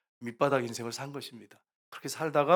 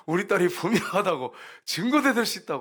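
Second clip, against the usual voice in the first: rising higher and louder, insisting; eager insistence; 4 semitones higher, 5 dB louder than usual. Retold or imitated. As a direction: retell the parents eagerly proving it. Parents insisting she is theirs.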